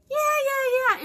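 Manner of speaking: high, loud impatient wife voice; giant's wife. Acted. Giant's wife cutting her husband off, high and impatient.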